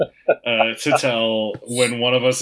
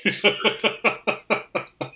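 nervous laugh; 6 semitones higher, 3 dB quieter than usual. Real, not acted. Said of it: "it's nervous laughter".

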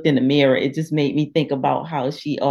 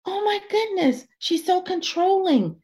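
much higher, breathless shocked realization; 11 semitones higher than usual. Retold or imitated. Reader realizing her friend is controlling, gasping and much higher. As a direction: gasp and discover the truth about her friend. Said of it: "you're reading this and you're like".

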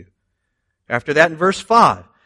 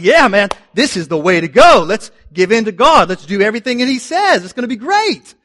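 high, loud, pumped-up crowd voice; eager Israelites; 6 semitones higher, 5 dB louder than usual. Pretend. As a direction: hype everyone up to obey God. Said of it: "they're like".

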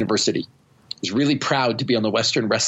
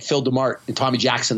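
ordinary delivery

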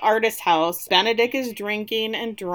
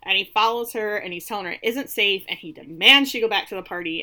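normal talking voice

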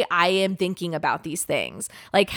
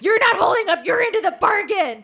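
high-pitched, hammed-up indignant rant; spiraling mock outrage; 12 semitones higher, 4 dB louder than usual. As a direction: blow up in mock, irrational outrage. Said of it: "it just, like, spiraled".

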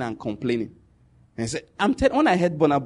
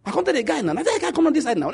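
higher, lively pidgin telling; Warri Pidgin, playful; 9 semitones higher, faster than usual. Retold or imitated. Narrator mimicking brother in pidgin, laughing lightly. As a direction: mimic a Warri man calling a friend over, laughing. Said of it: "speaking pidgin".

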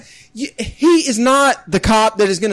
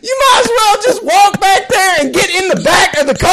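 laughing exasperation; 8 semitones higher, 3 dB louder than usual. Retold or imitated. Exasperated at friend.

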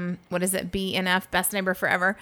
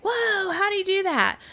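mock amazed guys; 11 semitones higher than usual. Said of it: "us were like".

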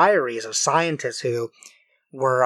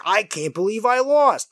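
higher-pitched beaten henchman; thug lackey voice; 5 semitones higher than usual. Acted. Beaten lackey after the battle, thug voice. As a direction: whine like a cartoon goon who lost. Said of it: "that was a really good voice".

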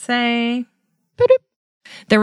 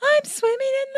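high, squeaky cry for help; cartoon squeak of mock panic; silly high voice. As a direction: squeal like a tiny creature drowning in beans. Silly cry after many flubs.

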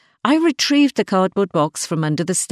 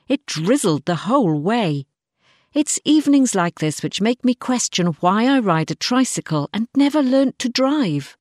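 usual speaking voice

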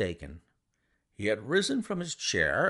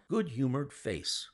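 ordinary delivery

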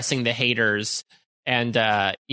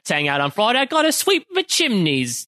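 high hammed-up Cockney chimney sweep; a silly Cockney voice for a joke; mock Cockney chimney sweep; 6 semitones higher than usual. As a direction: play a cheeky Cockney sweep, pitched high. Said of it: "doing our Night Angel impressions".

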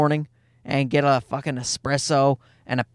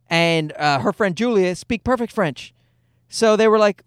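ordinary talk

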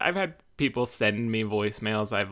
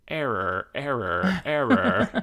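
robotic error call climbing much higher and louder at the end; a lightly voiced computer error alarm; brain glitching; 4 semitones higher, 4 dB louder, slower than usual. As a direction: play a malfunctioning robot. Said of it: "And then my brain goes".

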